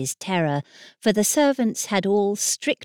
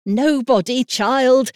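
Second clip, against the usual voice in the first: sharp, louder brush-off; almost angry; 7 semitones higher, 7 dB louder, slower than usual. Acted. Nurse cuts off the princess, sharp and louder. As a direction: snap it out to hush the child. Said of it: "almost angrily".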